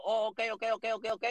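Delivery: brushing it off. Retold or imitated. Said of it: "ah, le digo".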